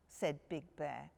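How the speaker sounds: ordinary delivery, nothing marked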